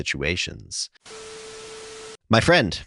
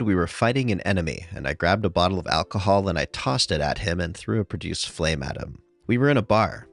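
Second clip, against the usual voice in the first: ordinary talk, nothing marked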